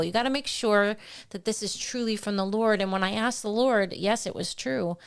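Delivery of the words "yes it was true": ordinary talk, nothing marked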